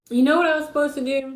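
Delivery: loud, fast blurt; sudden exclamation. Real, not acted. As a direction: burst out suddenly.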